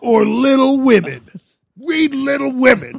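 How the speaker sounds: high, loud drunken dad impression; drunken slurred rant. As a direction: holler it like a plastered father. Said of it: "then he has the drunken, slurred line like".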